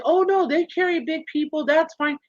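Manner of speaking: a light put-on of people telling her it's fine; mock reassurance. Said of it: "people say".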